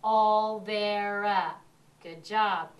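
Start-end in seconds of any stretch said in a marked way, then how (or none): 0.0-1.5 "Olvera" very slow and loud, three separate beats; clapped syllables. Shown as a demonstration. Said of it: "One more time".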